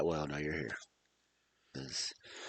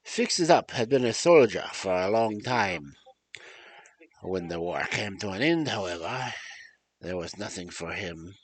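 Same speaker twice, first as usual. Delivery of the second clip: storytelling in a put-on French accent; French-accented storyteller, meant to be German. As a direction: read the tale aloud with a makeshift French accent. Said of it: "Why am I doing a French accent".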